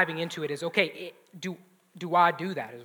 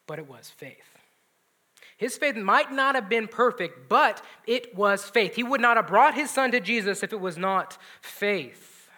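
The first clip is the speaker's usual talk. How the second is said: ordinary talk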